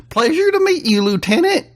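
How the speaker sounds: put-on high, twangy rural Southern accent; country drawl. A narrator's reading. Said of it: "his accent matching his attire".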